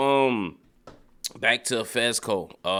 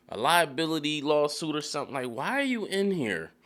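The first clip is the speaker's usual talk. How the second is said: exasperated rant; 5 semitones higher than usual. Real, not acted. Viewer ranting at Cassie running through construction, exasperated. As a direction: scold the character in disbelief. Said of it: "I was like".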